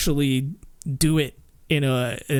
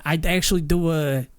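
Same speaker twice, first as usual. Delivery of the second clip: ordinary delivery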